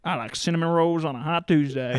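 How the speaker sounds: put-on lightly drawled Texas accent. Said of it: "your best Texas accent".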